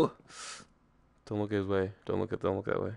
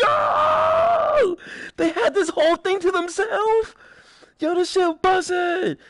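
excited yelling; slower than usual. Real, not acted. Thrilled by the cave build.

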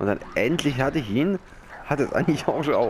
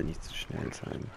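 whispered mutter; 5 semitones lower, 11 dB quieter, slower than usual. Real, not acted. Gamer reminding himself not to rush, whispered mutter. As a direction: whisper it slowly under the breath. Player repeats phrase softer.

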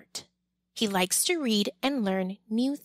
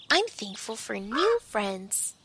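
plain reading voice

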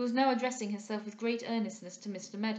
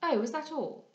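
higher-pitched cry of mild disappointment; disappointed; 6 semitones higher, 3 dB louder than usual. A narrator's reading. Young lady hearing the report, mildly disappointed. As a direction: let down, with a little exclamation. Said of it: "cried she, disappointed".

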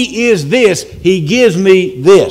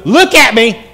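raised, louder insistent demand; erupting demand; 4 semitones higher, 5 dB louder than usual. Acted. Parent wanting pent-up words heard, erupting demand. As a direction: let it erupt at the kid. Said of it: "We want it to erupt on them".

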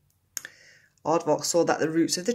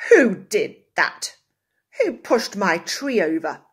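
angry demand; 4 semitones higher, 11 dB louder than usual. A narrator's reading. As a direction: demand an answer, furious. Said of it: "he demanded".

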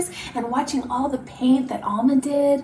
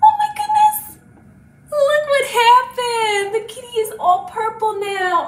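high, loud mock astonishment; amazed reveal; 9 semitones higher, 5 dB louder than usual. Teacher shows children the purple kitty, playfully astonished. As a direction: exclaim in pretend shock for little ones.

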